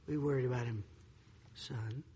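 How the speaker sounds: low, very soft fatherly warning; imitating a father's quiet, serious address. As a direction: drop down low like a dad to be feared. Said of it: "When he lowered his voice".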